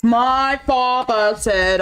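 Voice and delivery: ordinary talk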